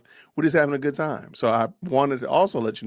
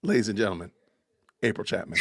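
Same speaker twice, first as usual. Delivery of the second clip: normal talking voice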